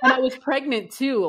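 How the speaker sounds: real giggly admission; amused laugh through the line. Real, not acted. Laughs at friend's teasing.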